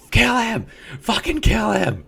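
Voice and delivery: high, loud bloodthirsty yell; crowd yelling. Retold or imitated. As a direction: scream for blood from the stands. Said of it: "They're like".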